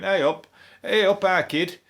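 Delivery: high, loud Mancunian greeting; Manchester accent. Retold or imitated. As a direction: greet them like a Manc mate. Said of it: "like somebody in Manchester".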